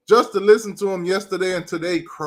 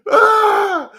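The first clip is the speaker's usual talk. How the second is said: gleeful shriek; 13 semitones higher, 7 dB louder than usual. Real, not acted. Burst of glee.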